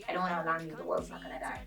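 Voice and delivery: low, soft aside; hushed reassuring aside. Acted. Vlogger reassuring viewers the world's fine, hushed aside. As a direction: drop it right down, almost under the breath.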